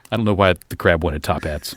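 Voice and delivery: ordinary talk, nothing marked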